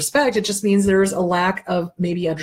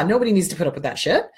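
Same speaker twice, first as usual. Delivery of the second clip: usual speaking voice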